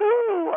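high-pitched mocking "ooh"; a high, exaggerated squeal of pretend alarm; mock squeamish "ooh". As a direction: coo it high in mockery. Mocks squeamish reaction to boyfriend.

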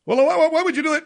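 very high, loud and fast; host imitating Matthews in a frantic squeal; mock panicked anchor. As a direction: screech the question in mock alarm. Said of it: "Matthews voiced again".

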